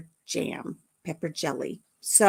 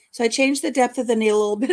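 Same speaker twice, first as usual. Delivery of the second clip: ordinary talk, nothing marked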